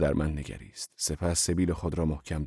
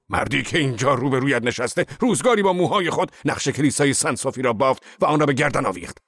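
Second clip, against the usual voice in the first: proud, booming, higher and louder; 8 semitones higher, 5 dB louder than usual. A narrator's reading. Angry Zorba boasting to his boss, booming loud. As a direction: declare it like a battle boast. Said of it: "با صدای بلند گفت".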